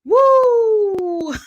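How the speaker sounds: high, sustained woo; loud whoop. Real, not acted. Venting after a heated reaction, loud whoop. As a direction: let off steam with a long, high whoop. Letting off steam after outrage.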